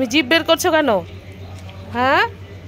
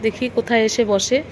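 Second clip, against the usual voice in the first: ordinary talk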